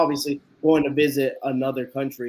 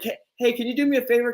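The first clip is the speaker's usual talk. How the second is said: polite request; 8 semitones higher, faster than usual. Retold or imitated. Asks stranger for a favor.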